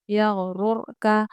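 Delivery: slow यगरुर with the ra in रुर full, not thin; heavy ra recitation. Shown as a demonstration. Teacher showing students a full heavy ra, slowly. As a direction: draw out यगरुर, ra said thick. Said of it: "रुर का बारीक नहीं पढ़ेंगे".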